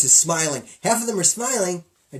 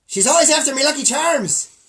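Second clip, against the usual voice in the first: a hint of a bad Irish accent, higher and louder; hammy Irish leprechaun line; 8 semitones higher, 6 dB louder than usual. Host doing the Irish cereal mascot for his co-host, bad Irish accent. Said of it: "Worst Irish accent".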